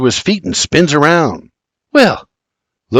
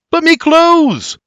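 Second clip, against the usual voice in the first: much higher, slow wailing holler; slower than usual. A narrator's reading. George hollering after Mary as she runs off, a high wail. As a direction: cry out after your stolen breeches. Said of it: "George hollers".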